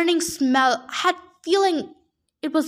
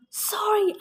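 quick, higher-pitched called apology; mild yell; 5 semitones higher than usual. A narrator's reading. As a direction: call it out as a restrained yell. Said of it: "I yelled".

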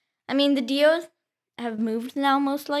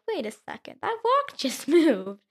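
surprised dad; 5 semitones higher than usual. Retold or imitated. Child quoting dad's surprised discovery.

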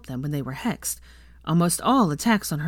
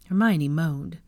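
plain reading voice

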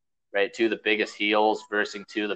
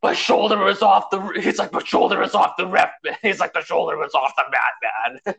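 Hulk Hogan impression; 8 semitones higher than usual. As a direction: protest like a cheated champ. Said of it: "he's just like".

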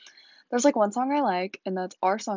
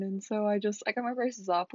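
ordinary talk, nothing marked

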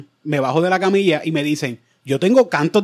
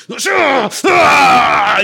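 sharp high cries of pain; pained yelps; 9 semitones higher, 6 dB louder, much slower than usual. Retold or imitated. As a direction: yelp out loud like it hurts. Reenacting scrub pain.

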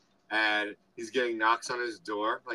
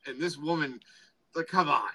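ordinary delivery

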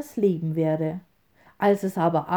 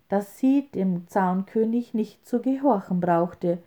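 ordinary delivery

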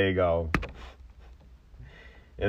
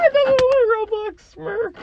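laughing squeal; 7 dB louder than usual. Real, not acted. Laughing at friend's Roblox face.

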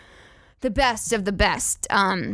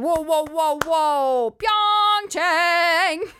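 higher, hyped-up sportscaster call; mock announcer; 5 semitones higher, much slower than usual. Comic imitating an Olympics announcer, hyped up. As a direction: announce it like an excited Olympics host.

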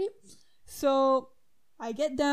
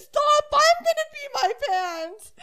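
much higher, louder laughing plea; friend cracking up; 13 semitones higher, 7 dB louder than usual. Retold or imitated. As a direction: beg her to stop through laughter.

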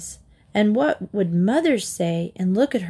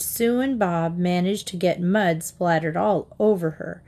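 ordinary delivery, nothing marked

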